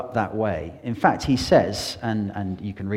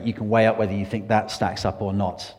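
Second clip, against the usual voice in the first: ordinary delivery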